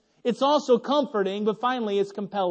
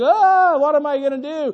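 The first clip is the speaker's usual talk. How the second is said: put-on panicked child; 5 semitones higher, 7 dB louder than usual. Kids smeared in diaper cream.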